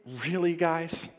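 a sighing, disbelieving really guys; exasperated Jesus. Retold or imitated. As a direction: play Jesus fed up with his students.

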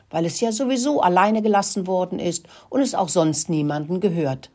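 ordinary delivery

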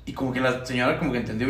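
usual speaking voice

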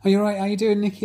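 high, loud sing-song greeting; imitating a cheery acquaintance; cheery greeter. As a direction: greet brightly like a chatty acquaintance. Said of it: "every time they say".

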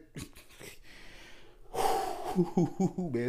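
long, breathy laugh rising high; real, drawn-out laugh; laughter. Real, not acted. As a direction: crack up in disbelief, wheezing at first. Laughing at men with hair glue.

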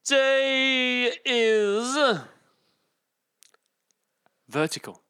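slow, high game-show announce; drawn-out announcer build-up. Acted. Dramatic word announcement.

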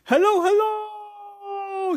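very high, long drawn-out call; shouted. Acted. Nate testing the strange house, high shouted hello. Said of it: "shouted Nate".

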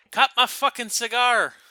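mock ghostly grandpa demanding his cigar; hammed-up dead grandpa barking from the coffin; grandpa from the grave. Grandpa voiced from beyond grave.

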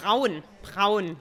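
Braun with the R fully pronounced in the throat; German R. Shown as a demonstration. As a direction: demonstrate Braun the German way, rhyming with brown. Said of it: "we really pronounce the R".

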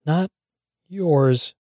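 weak, halting reply; wilting resolve. A narrator's reading. Beaten man answering thugs, wilting resolve. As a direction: manage it as your resolve wilts. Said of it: "all I can manage with wilting resolve".

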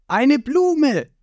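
high, loud startled cartoon cry; shouting cook. Sanji spotting the old man, startled cartoon cry. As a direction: point and blurt it out. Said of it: "zeigt Sanji auf ihn und schreit".

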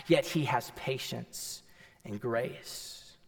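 low, soft and slow sermon voice; tender hush. Acted. Pastor gently explaining grace to listeners, hushed and tender. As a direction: bring it right down and let it land. Preacher softens to tender point.

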